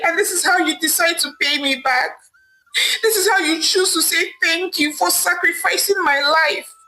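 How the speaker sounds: high, tearful wail of a betrayed wife; crying and wailing. Acted. Wife crying out to her cheating husband, wailing. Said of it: "Amaka said aloud, crying and wailing".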